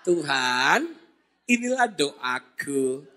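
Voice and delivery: slow, low, soft Solonese prayer; drawn-out, subdued mock prayer; Solonese praying. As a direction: parody a very mild-mannered Solo worshipper. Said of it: "Apalagi kalau orang Solo doa".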